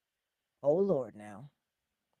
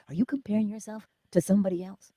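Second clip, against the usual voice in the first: ordinary talk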